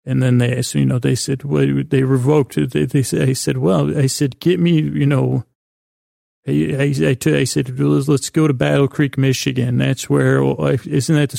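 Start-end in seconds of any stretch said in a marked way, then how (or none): none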